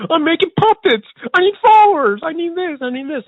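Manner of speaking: high, loud, desperate yell; hammed-up pleading shout that fades down; begging for followers. Puppeteer imitating a small creator begging for followers, mock desperate yell. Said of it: "begging people to follow me".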